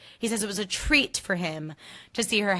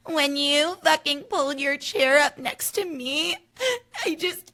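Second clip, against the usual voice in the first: high, slow teary whine; mock tearful Amanda; 8 semitones higher, slower than usual. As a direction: whine it through fake tears. Imitating Amanda crying in clip.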